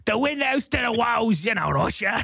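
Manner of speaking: high, slow heckler voice; different voice. Acted. Heckler taunting the usher, high drawn-out character voice. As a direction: drag it out in a high put-on voice. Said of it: "it's a different voice".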